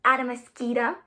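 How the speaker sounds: lively rhythmic read-aloud; bouncy storytime rhythm; reading to children. A narrator's reading. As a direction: read it like a children's picture book.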